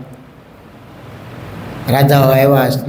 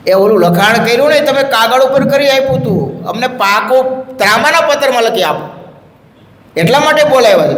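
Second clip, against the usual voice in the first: higher, lively master's voice; Maharaj explaining; 9 semitones higher than usual. Acted. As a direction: reveal the reason with relish.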